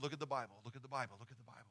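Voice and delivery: fading repeat. Retold or imitated. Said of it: "He always said".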